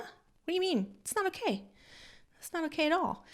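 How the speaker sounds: high, small upset voice, acted out; mock tearful protest. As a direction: imitate protesting through tears. Retells tearful reply to friend.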